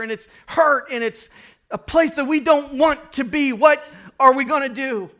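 the thirsty people grumbling to Moses, high and whiny; complaining Israelites. Retold or imitated. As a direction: whine like thirsty people in the desert. Israelites complaining, voiced by preacher.

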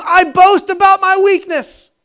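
high, loud, slow proclamation; Paul's proud boast. Retold or imitated. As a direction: declare it like a triumphant apostle. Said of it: "He says".

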